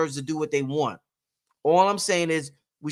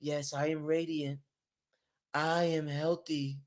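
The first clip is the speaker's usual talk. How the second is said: much softer, slow mantra; mock affirmation; 11 dB quieter, slower than usual. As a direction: murmur it serenely to yourself. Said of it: "over there like".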